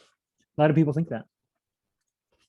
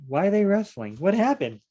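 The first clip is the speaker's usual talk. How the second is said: baffled surprise; 7 semitones higher, 4 dB louder than usual. Real, not acted. Puzzling over a strange verse.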